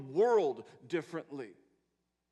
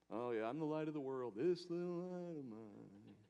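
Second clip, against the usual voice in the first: dismissive mock; 5 dB quieter than usual. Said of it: "made that statement too small".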